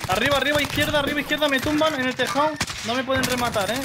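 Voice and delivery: urgent callout. Real, not acted. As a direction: call it out fast while going down. Teammate shot down calling enemies.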